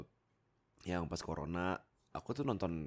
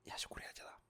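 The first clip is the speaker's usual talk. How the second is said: whispered aside; 11 dB quieter, faster than usual. Real, not acted. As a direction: breathe it out softly. Bisikan setelah nasihat.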